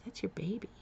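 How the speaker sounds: soft whisper; whispered answer. Retold or imitated. Nurse quietly telling her about the ultrasound, in a soft whisper. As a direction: whisper it gently. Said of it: "And she said".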